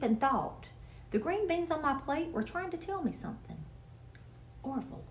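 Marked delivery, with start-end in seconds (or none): 4.6-5.0 soft, hushed name call; whispering. A narrator's reading. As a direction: call him softly so mom won't hear. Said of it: "I whispered".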